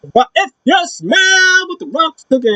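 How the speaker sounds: high, hyped Rock impression; Rock catchphrase. Fan imagining The Rock showing up, hyped. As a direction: sell the catchphrase big. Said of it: "I'll be like".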